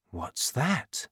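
soft, puzzled murmur; talking to himself. A narrator's reading. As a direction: wonder under your breath. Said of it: "he said to himself".

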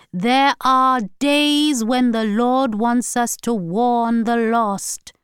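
slow, high, loud grandmother preaching; preachy Hortense. A narrator's reading. Grandmother overriding tired granddaughter with scripture, preachy. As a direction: cut in with a slow, solemn warning. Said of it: "Hortense continued".

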